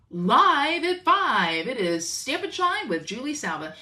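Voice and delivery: booming, slow TV anchor intro; news anchor voice. Shown as a demonstration. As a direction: announce it like the evening news. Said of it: "I wanna talk like a news anchor".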